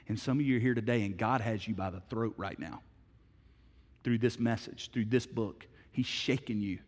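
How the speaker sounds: usual speaking voice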